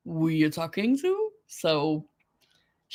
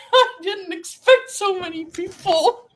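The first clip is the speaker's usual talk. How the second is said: high, laughing and amazed at the crowd; laughing disbelief; 10 semitones higher than usual. Real, not acted. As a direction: laugh it out in happy shock. Surprised by a big live audience.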